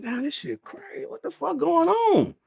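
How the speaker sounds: high, soft and slow, half to himself; quietly stunned, drawing the words out; hushed disbelief. Retold or imitated. Muttering to himself in the holding cell, soft slow disbelief.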